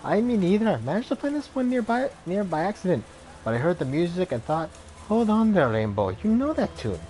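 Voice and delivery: a gamer woman, lilting Irish, interrupted at play; lyrical Irish accent. Acted. Gamer woman brushes off a stranger, lilting Irish accent. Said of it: "lyrical accent".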